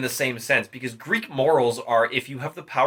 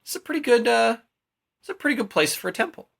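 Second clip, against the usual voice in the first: higher voice, like a laid-back dude; breezy higher-pitched dude voice sizing up a building site; casual Apollo; 9 semitones higher, faster than usual. Retold or imitated. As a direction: play the god as a chill surfer type. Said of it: "he's like".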